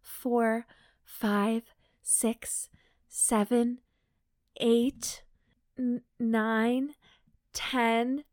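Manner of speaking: submissive counting. A narrator's reading. Submissive character counts aloud.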